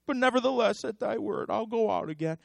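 lightly voiced weary sulk; sulky Simon. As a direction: mutter it like a tired fisherman. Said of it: "He sulked for a minute".

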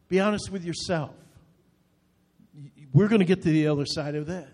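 normal talking voice

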